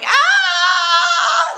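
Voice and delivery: high, loud fleeing yell; panicked shout. Pretend. Running away from her mother, a panicked shout. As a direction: shout Ah as you run. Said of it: "run away going".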